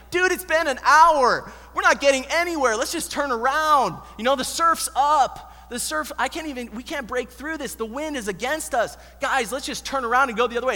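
whiny mock protest to the crew; complaining doubting Thomas. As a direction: play the doubter who wants to quit rowing. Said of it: "I can just imagine doubting Thomas".

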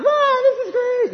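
put-on very high, squealed little-girl voice; slow, loud squeal of mock delight; delighted child. Young daughter running round the baby store, squealing happily. Scarlett's in there running around.

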